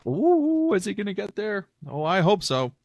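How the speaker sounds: high-pitched playful oohing onlooker; mock suspense. Podcaster voicing listeners waiting as he flips pages, mock suspense.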